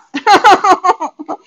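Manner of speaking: loud high burst of laughter; a bright, loud laugh at her own joke. Real, not acted. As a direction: let out a big real laugh. Laughing after shooing cards off.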